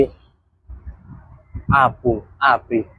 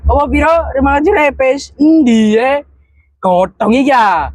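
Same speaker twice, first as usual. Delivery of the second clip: excited; 10 semitones higher, slower than usual. Real, not acted. Laughing over the wallet money.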